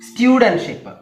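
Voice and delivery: സ്റ്റുഡന്റ്ഷിപ്പ് opened high and loud; first-syllable stress. Shown as a demonstration. Teacher models the suffix word, stressing the start of സ്റ്റുഡന്റ്ഷിപ്പ്. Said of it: "first syllable ആണ് stress".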